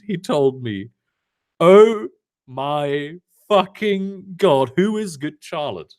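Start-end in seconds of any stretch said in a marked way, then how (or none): none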